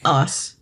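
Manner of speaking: "arse" as a long "ahss". Shown as a demonstration. Brit models the word for co-host, "arse" without the R. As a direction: say "arse" with the R silent. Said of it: "you don't pronounce the R".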